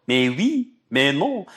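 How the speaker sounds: loud, high mock French "Mais oui, mais non"; exasperated French accent parody. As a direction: toss it off in a hammed-up French accent. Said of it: "French accent".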